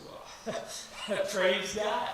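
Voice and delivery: breaking into a laugh, then loud praise God; delighted laugh into a loud praise God. Retold or imitated. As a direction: laugh with delight at his news. Retelling joyful reply.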